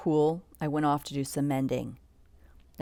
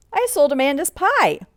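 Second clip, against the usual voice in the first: proud; 9 semitones higher, 6 dB louder than usual. A narrator's reading. Pa announces his news.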